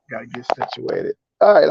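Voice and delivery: ordinary talk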